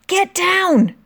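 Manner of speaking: sharp high shout of warning; yelled warning. A narrator's reading. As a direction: yell it as planes dive. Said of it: "yelled Molly".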